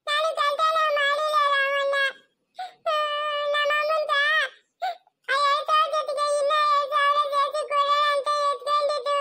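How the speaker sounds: high, drawn-out crying plea; wailing child's confession, dragged out; tearful denial. Acted. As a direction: protest your innocence through sobs. Child tearfully denies stealing when accused.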